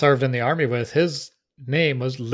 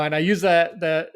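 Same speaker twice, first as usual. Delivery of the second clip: ordinary talk, nothing marked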